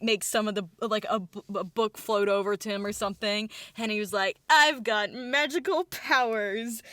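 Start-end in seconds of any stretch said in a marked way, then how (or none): 4.5-6.8 slow, stretched-out proclamation; dramatic boast. Retold or imitated. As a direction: announce it grandly, word by word. Ambition shows off his powers.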